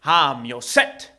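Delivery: slow, loud magic spell; drawn-out, forceful character chant; old shoemaker's incantation. Acted. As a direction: intone each word like a conjurer. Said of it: "he speaks these words".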